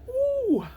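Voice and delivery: very high loud pucker ooh; sour ooh. Shown as a demonstration. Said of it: "I'm okay with going the".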